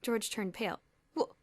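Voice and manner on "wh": high, loud, startled stammer. A narrator's reading. George stammering in fear at Boots's news. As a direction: trip over the first word in fright. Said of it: "he stammered".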